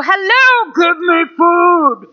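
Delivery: hammed-up high, slow yell; a growling stomach demanding to be fed, played big; mock yelling. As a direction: stretch it out like a hungry mock yell. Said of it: "yell that loud".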